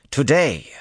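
high, loud single-word snap; snapped. A narrator's reading. Commander correcting her officer, snapping. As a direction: hit it hard and high, impatiently. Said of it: "she snapped".

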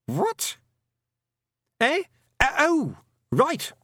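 usual speaking voice

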